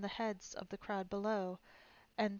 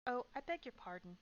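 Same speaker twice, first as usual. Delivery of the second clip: dismay; 4 semitones higher, 4 dB quieter than usual. A narrator's reading. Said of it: "in a tone of great dismay".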